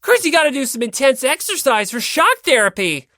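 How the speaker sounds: loud high eager call; eager shouting. Acted. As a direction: push them to exercise. Owari urges intense exercise.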